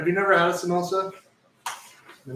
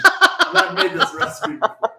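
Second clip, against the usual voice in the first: high, louder laugh through words; 10 dB louder, slower than usual. Real, not acted. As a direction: laugh it out sheepishly. Laughing admitting first try.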